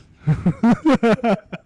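high, loud laugh; real laugh. Real, not acted. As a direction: burst out laughing. Laughing at own story.

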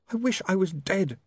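high, lightly acted sob; sobbing. A narrator's reading. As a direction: wish it through a touch of tears. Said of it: "she sobbed".

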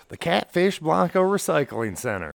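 announcer voice. Acted. Mock sponsor read.

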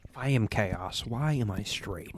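breathy whisper. Pretend. Whispered joke line.

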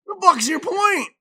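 loud, high-pitched backtalk; mock defiant kid. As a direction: imitate a cheeky kid answering mom back. Child talks back to mom.

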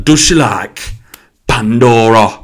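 high, loud mock Nottingham catchphrase; Nottingham hairdresser. Hairdresser's question echoing in customer's head, loud mock Nottingham. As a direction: hammer the question in exaggerated Nottingham. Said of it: "every time I hear the word Pandora".

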